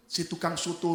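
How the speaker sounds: normal talking voice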